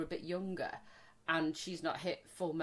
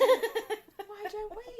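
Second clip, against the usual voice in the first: laughing; 13 semitones higher, 4 dB louder, much slower than usual. Real, not acted. Laughing exclaim.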